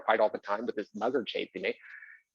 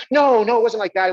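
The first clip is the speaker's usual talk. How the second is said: flustered denial; 9 semitones higher, 8 dB louder than usual. Retold or imitated. Producer denying an attack.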